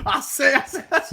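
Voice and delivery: high cartoon Southern rooster; blustery, hammed-up Southern catchphrase; Foghorn Leghorn. Host riffing on co-host's remark, high mock Foghorn Leghorn. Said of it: "almost a foghorn leghorn vibe to it".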